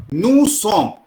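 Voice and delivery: "Nous sommes" ending on the M sound; silent last letter. Shown as a demonstration. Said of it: "last letter नहीं pronounce करेंगे". ("Nous sommes" में आख़िरी S चुप, "नू सॉम")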